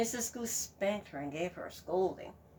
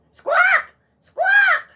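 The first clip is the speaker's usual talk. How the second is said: high shrieking squawks; goose squawk; 18 dB louder than usual. Acted. Goose squawking to wake the farmer, shrieking.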